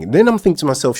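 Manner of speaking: ordinary talk, nothing marked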